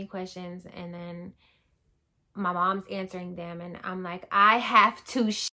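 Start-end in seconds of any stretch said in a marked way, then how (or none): 4.3-5.5 louder, slow, dragged-out cry of need; blurting it out in desperation; urgent outburst. Retold or imitated. Desperate in labor at desk.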